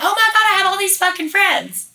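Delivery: high, gushing mock excitement; naive delight. Lonely newcomer marvelling at new Facebook friends, naively thrilled. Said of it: "I'm so naive".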